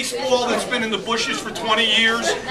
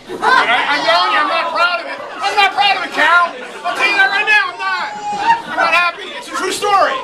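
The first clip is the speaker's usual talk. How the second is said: agitated; 10 semitones higher, 3 dB louder than usual. Real, not acted. Remorseful confession, laughing.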